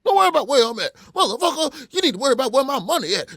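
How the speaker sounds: loud, high, furious tirade; going off on him. Retold or imitated. Prank caller posing as store owner, going off on him. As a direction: yell it fast, spoiling for a fight.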